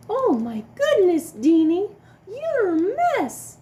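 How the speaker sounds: high, loud shocked mama voice; exaggerated startled mother; broad singsong kids theatre. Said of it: "his mama said".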